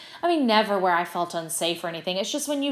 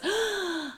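high, drawn-in shocked gasp; audience gasp; 12 semitones higher than usual. Retold or imitated. Audience seeing Mary fall, imitated shocked gasp. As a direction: imitate a whole crowd gasping. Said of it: "the whole audience goes".

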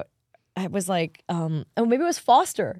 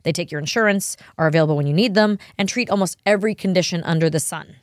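louder, upbeat sponsor pitch; ad read; 2 semitones higher, 7 dB louder than usual. Acted. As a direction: sell it like a podcast ad.

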